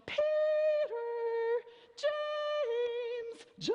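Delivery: high, carrying call across the neighborhood; calling out names. Retold or imitated. Mother calling her kids home, high sing-song call. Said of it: "she'd come out and she'd go".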